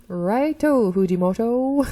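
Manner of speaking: loud, goofy put-on professor; crazy professor voice. Professor calling Fujimoto up, crazy put-on voice. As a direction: call out in an over-the-top odd voice. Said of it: "I don't know what I'm doing with his voice".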